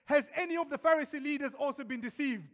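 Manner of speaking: an angry court official rounding on his guards; furious accusation. Retold or imitated. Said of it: "he looks around the courtroom".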